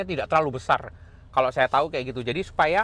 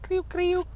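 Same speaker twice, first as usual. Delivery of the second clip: crunch sound imitation. Shown as a demonstration. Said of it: "ditambahin kulitnya".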